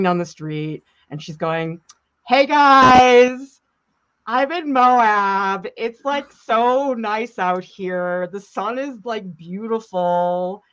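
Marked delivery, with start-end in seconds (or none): 2.3-10.6 high, slow, drawn-out influencer voice; livestreamer voice. Retold or imitated. Imitating a woman live streaming on a Utah street, drawn-out vlogger gush. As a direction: stretch every phrase out, loud opening for the followers. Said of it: "this is the voice of this woman".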